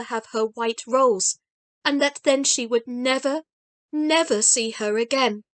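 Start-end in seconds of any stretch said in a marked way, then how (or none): none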